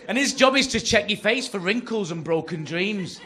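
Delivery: high, shouted comic tirade; outraged rant. Acted. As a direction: rage at full volume in disbelief. Acted outraged rant about night worker.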